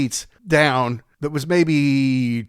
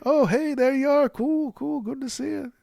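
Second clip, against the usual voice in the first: much higher put-on voice of a famous Beatle saying hello; [name] impression; 11 semitones higher, 4 dB quieter than usual. Comedian imitating the Beatle greeting him, higher voice. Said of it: "he did a great [name] impression".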